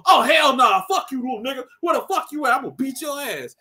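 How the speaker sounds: high, loud mock threat; hammed-up trash talk; mock angry gamers. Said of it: "They like".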